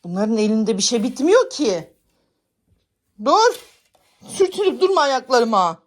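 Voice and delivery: loud high sharp scolding; scolding the cats. Real, not acted. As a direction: snap at the cat under her feet. (ayağına sürtünen kediyi azarlama)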